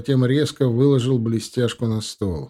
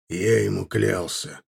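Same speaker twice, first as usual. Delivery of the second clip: curt; 2 semitones lower than usual. Acted. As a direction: answer with few words, grudging. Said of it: "скупо ответил Аркар".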